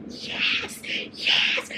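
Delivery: soft breathy whispered yes. Real, not acted. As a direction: whisper it to yourself in delight. Whispered joy at epilogue.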